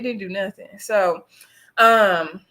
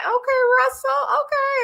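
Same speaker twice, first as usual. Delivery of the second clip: much higher, sing-song teasing okay; squealing in playful disbelief. Real, not acted. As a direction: tease him with a knowing okay. Teasing Russell about club days.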